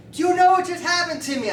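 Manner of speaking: high, loud, bursting question; acted excited announcement. Beer drinker has news for the chiropractor, loud excitement. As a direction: burst out with it, voice shooting up.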